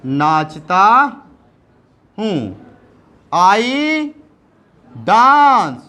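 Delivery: usual speaking voice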